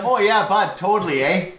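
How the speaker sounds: high, laughing mock Canadian; Canadian accent. GM bantering with players in a mock Canadian accent. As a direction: lay the Canadian on thick. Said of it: "He just goes full Canadian".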